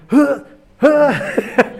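high, loud mock gasps; mock aghast noises. Retelling his reaction to the chopped snake, mock aghast gasps. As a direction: let out two exaggerated, high huffs. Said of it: "I'm standing there going".

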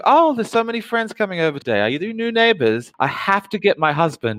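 high, sugary hostess voice; sweet 1950s housewife. Acted.

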